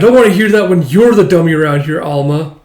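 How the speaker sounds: loud, high, huffy comeback; girl snapping back at Alma; indignant retort. Acted. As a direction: fire back, offended.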